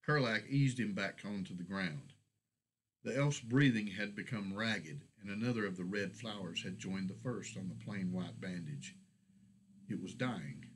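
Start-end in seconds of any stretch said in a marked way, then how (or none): none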